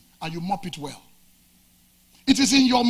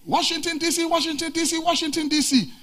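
high, loud conductor's cry, repeating the stop; bus conductor calling out; 9 semitones higher, 4 dB louder than usual. Acted. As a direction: hawk the route like a bus conductor at the roadside. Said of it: "the conductor who is saying".

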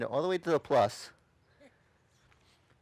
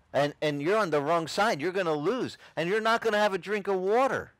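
raised, insistent plea, retold; urgent warning; 7 semitones higher than usual. Born-again convert warns Satanist friend, urgent warning. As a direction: warn a friend with rising urgency.

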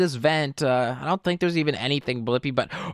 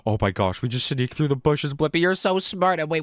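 normal talking voice

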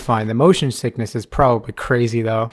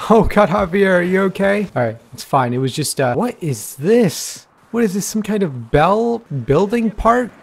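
ordinary delivery

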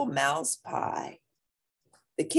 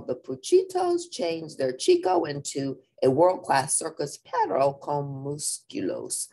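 ordinary delivery

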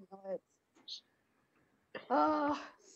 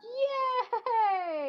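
shrieking jackpot winner; 10 semitones higher, 3 dB louder than usual. Real, not acted. Sees herself about to win.

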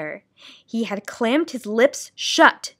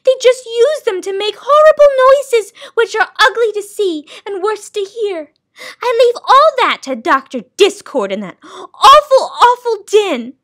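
loud, high, indignant tirade; high-pitched, overwrought disgust; soundkeeper, outraged; 12 semitones higher, 9 dB louder than usual. Acted. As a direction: rail in disgust, wailing the last words.

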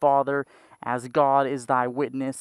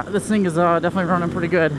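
usual speaking voice

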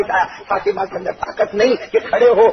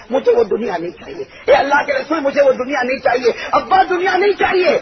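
soaring sermon climax; 6 semitones higher than usual. Acted. Preacher to the crowd, rising ecstatic cry. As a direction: carry the crowd to rapture.

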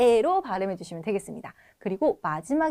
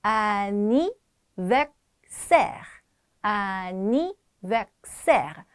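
anniversaire broken into slow, separate syllables; much slower than usual. Shown as a demonstration. Teacher takes students through the word, very slow pronunciation. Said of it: "제가 천천히 발음해 볼게요".

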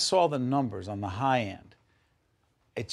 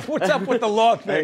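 laughing; 11 semitones higher, 8 dB louder than usual. Real, not acted. Host laughs at law coincidence.

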